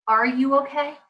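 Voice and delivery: "Are you okay" as an open question; caring question. Shown as a demonstration. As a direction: ask it gently, leaving room to answer. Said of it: "how about this?".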